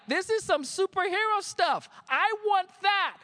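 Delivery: high-pitched, excited outburst; thrilled awe. Retold or imitated. Acts out amazed younger self.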